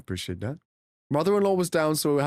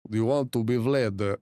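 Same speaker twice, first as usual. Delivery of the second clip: low vampire voice; a touch of Eastern European vampire; Vampire Vlad; 6 semitones lower than usual. Acted. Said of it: "versus Vampire Vlad".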